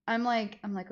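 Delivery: ordinary talk, nothing marked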